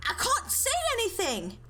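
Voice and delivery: panicked shouting. Acted. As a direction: yell in alarm through smoke. Panicked characters after blast.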